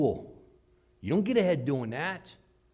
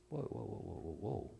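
hushed whoa; 6 semitones lower, 13 dB quieter than usual. Acted. Hearer balking at suffering.

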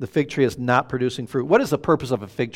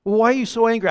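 higher-pitched retold wifely challenge; questioning wife; 7 semitones higher, faster than usual. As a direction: imitate a wife pressing for answers. Replaying wife's questioning in a quarrel.

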